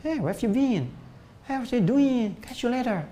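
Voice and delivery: high-pitched mimicked native English speaker; casual English greeting in a high imitation accent. Shown as a demonstration. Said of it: "Bahasa Inggris kayak orang Inggris".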